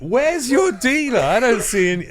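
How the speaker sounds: high, drawn-out naive call. Retold or imitated. Storyteller replays calling to two guys for their dealer, naive high call.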